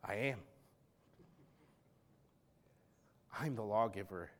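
soft, weighty I am; quiet solemn declaration; hushed claim. Retold or imitated. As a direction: drop to a near-hush for the claim. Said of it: "He goes".

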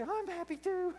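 high, excited mock wife voice; delighted wife. Wife answers her husband saying he's happy, delighted.

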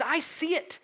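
high, emphatic declaration as God; a high, ringing divine declaration; God declaring. Acted. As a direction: proclaim it like God himself.

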